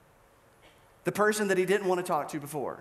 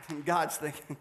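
ordinary delivery

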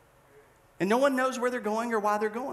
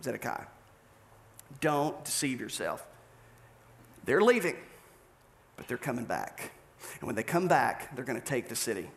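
a hint of a grave prophet; 7 semitones lower than usual. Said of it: "And Jeremiah said".

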